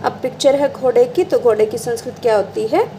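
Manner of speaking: normal talking voice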